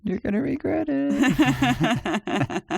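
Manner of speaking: put-on high, drawn-out teen surfer girl. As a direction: stretch the warning like a surfer girl. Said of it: "my seventeen-year-old surfer girl voice".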